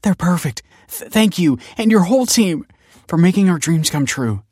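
high, choked-up happy thanks; overwhelmed with grateful joy; tearful joy. A narrator's reading. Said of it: "grinning from ear to ear with watery smiles".

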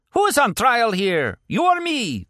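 an angry warlord hitting back at his accuser; furious retort. A narrator's reading. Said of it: "the warlord retorted furiously".

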